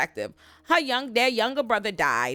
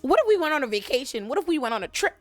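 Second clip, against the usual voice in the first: much higher breezy mock suggestion; chirpy Lestat; 9 semitones higher than usual. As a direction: pitch a vacation like it fixes everything. Lestat cheerfully proposing a vacation.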